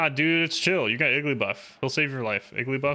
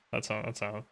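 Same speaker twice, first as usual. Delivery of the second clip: usual speaking voice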